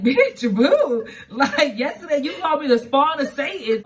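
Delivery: high, loud, laughing disbelief; incredulous laughing reply. Retold or imitated. Partner reminding her of yesterday's insult, laughing incredulous. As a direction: squeal it through laughter, outraged.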